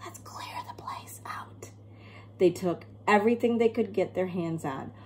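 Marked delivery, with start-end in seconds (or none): 0.0-1.7 burglar's unvoiced whisper; whispering to clear the house. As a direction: keep it hushed, a thief at work. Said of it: "whispered the first burglar".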